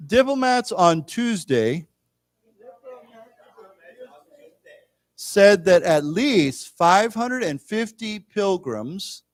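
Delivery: slow, measured reading for a class; read-along. Shown as a demonstration. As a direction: read slowly in phrases so learners can follow. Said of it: "let's read this together".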